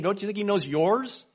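normal talking voice

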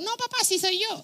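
very high, soft disguised voice; comic high-pitched impostor; Jacob. Acted. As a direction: pretend to be your brother in a falsetto. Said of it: "Hablaba así".

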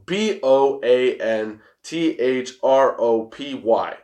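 slow, letter by letter; spelling out. Shown as a demonstration. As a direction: spell it out carefully, each letter separate. Spells out the hard word.

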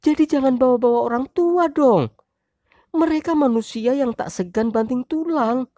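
high, loud wife voice, angry. Acted. Wife shouting at husband over her parents, high loud voice. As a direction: let the wife shout in defense.

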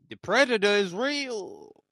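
loud, slow dramatic declaration; loud announcement. Real, not acted. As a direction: proclaim it big and slow. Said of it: "loud announcement take".

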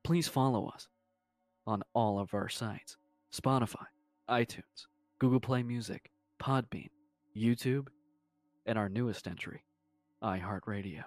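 podcast announcer read; promo voice. Acted. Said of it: "let me do a promo for you real quick".

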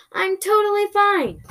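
high, exaggerated fake reassurance; sarcastic. After being carried upside down, sarcastic. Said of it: "I said sarcastically".

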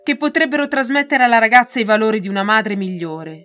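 a hint of a threatening Russian general; menacing general. A narrator's reading. Rakov threatens Marina.

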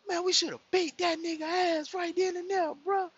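high-pitched, worked-up imitation; heated retold outrage, high throughout; offended brother. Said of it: "my brother get real offended".

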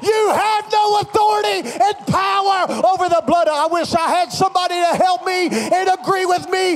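shouted rebuke of covid; fierce commanding prayer. Acted. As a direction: preach it out with fire. Preaching at full force against covid.

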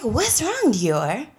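soft, high, careful questioning; gently concerned. Retold or imitated. Woman retells asking a crying girl what's wrong, gently concerned. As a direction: imitate gently coaxing someone who is tearing up. Retold asking a tearful child.

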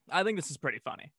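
ordinary talk, nothing marked

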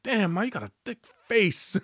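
high, odd-voiced cheesy line; mock catcall, high; mock pickup line. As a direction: deliver it laughing at himself. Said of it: "It's like".

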